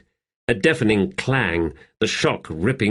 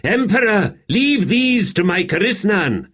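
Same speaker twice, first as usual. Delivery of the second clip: much higher, louder call; 12 semitones higher, 4 dB louder than usual. A narrator's reading. Hanan Mossag urging the Emperor to step back, called out loud. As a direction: call across the battlefield to the Emperor. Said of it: "Hanan Mossag called".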